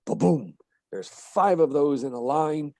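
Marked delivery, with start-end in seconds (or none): 0.0-0.5 ba-BOOM, weight on the second syllable; one heartbeat "ba-boom", stressed on boom; short ba, stronger boom; iambic beat. Shown as a demonstration. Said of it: "beats that go".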